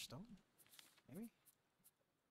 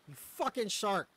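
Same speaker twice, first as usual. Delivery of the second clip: angry; 4 semitones higher, 7 dB louder, slower than usual. Real, not acted. Cursing the shark.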